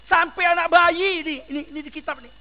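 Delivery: usual speaking voice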